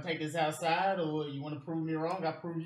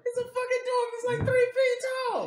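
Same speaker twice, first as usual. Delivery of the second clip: mocking; 6 dB louder than usual. Real, not acted. Mocking the dwarf's size.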